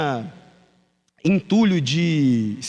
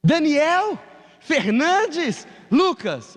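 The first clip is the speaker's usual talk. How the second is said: much higher, drawn-out shouted call of the full name; mother yelling full name; 11 semitones higher, much slower than usual. Retold or imitated. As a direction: call the boy's whole name like an angry mom. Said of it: "minha mãe grita". (mãe gritando o nome completo do filho, bem agudo e arrastado)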